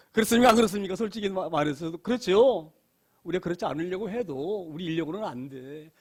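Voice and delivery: normal talking voice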